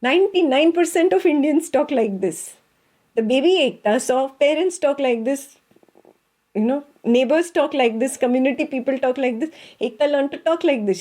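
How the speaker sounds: high, lively, quick-running Indian delivery; animated Indian talk. Shown as a demonstration. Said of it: "Very Indian way of talking".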